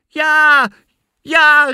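very high, very loud cheering; jubilant cheer. A narrator's reading. Sister spots help from the tower, cheering. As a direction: cry out in triumph. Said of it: "riep Anna juichend".

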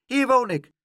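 put-on high excited child's voice; proudly pointing out his home; little boy. A narrator's reading. Little boy shows Pluk his door, high and excited.

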